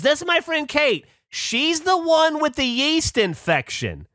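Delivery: very high, slow, hollered introduction; exaggerated high-pitched loud woman impression; loud American lady. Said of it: "except they're very loud".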